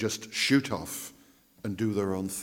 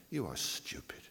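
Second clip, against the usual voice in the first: scornful whisper; 2 semitones lower, 11 dB quieter than usual. Acted. People scorn the cranks.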